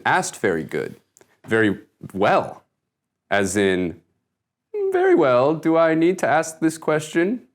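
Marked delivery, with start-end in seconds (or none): 4.9-7.5 higher-pitched rhetorical question to oneself; mock deliberation. Interviewee acting out weighing the question, mock deliberation. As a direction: put on a higher, self-questioning voice. Acts out asking and answering himself.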